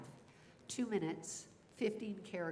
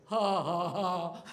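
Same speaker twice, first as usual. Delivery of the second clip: awkward fake laugh; 9 dB louder, much slower than usual. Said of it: "or they'll go".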